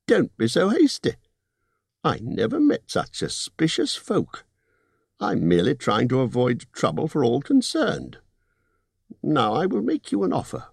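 hasty Bard calmed by a high squeaky hobbit; 高い声でなだめるビルボ. Acted. Bilbo voiced in story.

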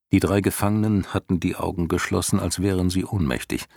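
usual speaking voice

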